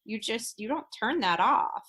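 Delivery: usual speaking voice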